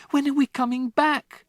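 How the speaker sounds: put-on high, desperate child's question; pleading for an answer; anxious girl. Matilda begging her father, high and desperate. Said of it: "Matilda cried".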